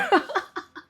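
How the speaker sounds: high, short falling laugh; a quick laugh at herself. Real, not acted. Laughing at being into water, high and short.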